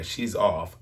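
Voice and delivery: ordinary talk, nothing marked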